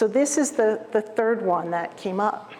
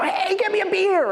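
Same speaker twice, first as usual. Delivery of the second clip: much higher, casual buddy call; outside voice; 10 semitones higher than usual. Retold or imitated. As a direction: toss it to a friend over your shoulder. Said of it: "maybe outside voice".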